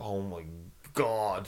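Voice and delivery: low, soft, weary retold sigh of words; deflated despair. Passenger is sent back by security, retold deflation. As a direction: let the voice sink in acted dismay.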